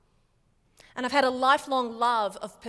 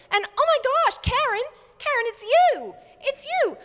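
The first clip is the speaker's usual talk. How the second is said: very high, loud excited squeal; astonished excited friend; 14 semitones higher, 8 dB louder than usual. Retold or imitated. Imitating friend who recognised the GPS voice, thrilled squeal. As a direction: burst out in high-pitched amazement.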